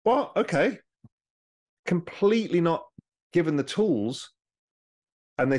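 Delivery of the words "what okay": mock surprise, jumping much higher; a startled, befuddled "what?"; baffled voters. Voters told to decide Brexit instantly, in mock surprise pitched high. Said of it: "And everyone's like".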